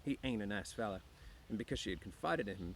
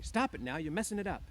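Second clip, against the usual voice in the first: loud, high-pitched angry protest; angry cry; 8 semitones higher, 6 dB louder than usual. A narrator's reading. Woman whose hair is being mussed, crying out angrily. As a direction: cry out crossly, pitch raised. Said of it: "she cried angrily".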